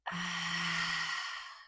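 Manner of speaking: long audible exhale through an open mouth; open-mouthed sigh. Shown as a demonstration. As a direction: let the breath pour out in a sigh. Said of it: "open the mouth".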